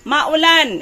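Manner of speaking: loud high echo of "Maulan"; emphatic repeat. Real, not acted. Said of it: "kasi maulan".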